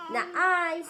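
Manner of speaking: high, loud "Not I"; cat refusing. Acted. Mother voicing the cat's refusal to her son, high and loud. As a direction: turn the hen down sharply.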